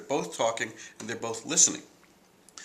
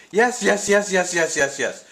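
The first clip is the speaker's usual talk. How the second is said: excited; 9 semitones higher, 8 dB louder than usual. Retold or imitated. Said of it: "jumped up and down and said".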